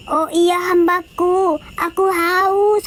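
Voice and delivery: put-on high, slow babyish whine; a baby begging to be fed; baby Jesus voice. Baby Jesus whines to his mother in a high slow voice. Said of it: "katanya kan".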